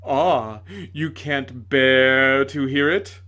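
loud, slow, gloating shout; miserable triumph. A narrator's reading. Tragedian gloating at the lady, loud bitter shout. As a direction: crow at her in bitter victory. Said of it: "he shouted with miserable triumph".

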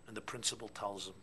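normal talking voice

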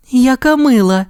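high, tender coo; touched. A narrator's reading. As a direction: melt at a cute bat. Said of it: "розчулено сказала мама Зая".

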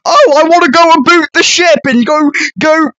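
high-pitched hammed-up Canadian accent; mock a Canadian accent in a high voice. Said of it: "of course the Canadian comes in".

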